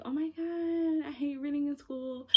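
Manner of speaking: mock whiny student, stretched oh my God; hammed-up complaining schoolgirl; whiny bored student. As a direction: lightly mimic a student who hates reading. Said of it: "I was not someone who was like".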